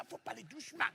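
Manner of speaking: very soft hushing; whispered shush. Retold or imitated. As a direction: barely breathe it out. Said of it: "il fait comme ça, doucement".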